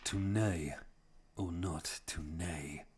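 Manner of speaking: slow, drawn-out quiet declaiming; mock Shakespearean. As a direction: recite it slowly like a lightly hammed bard. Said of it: "Or my favourite one".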